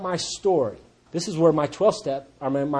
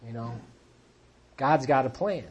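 ordinary talk

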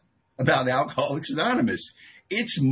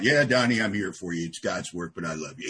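mock-humble reassurance; 7 semitones lower than usual. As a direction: play the falsely modest sponsor. Acts himself humbly taking the credit.

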